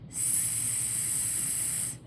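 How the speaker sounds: unvoiced hissed s; isolated s. Shown as a demonstration. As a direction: hiss the s sound. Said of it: "Short I".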